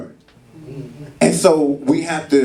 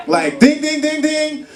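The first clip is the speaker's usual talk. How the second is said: imitated card beep; 11 semitones higher than usual. Card declined at grocery store.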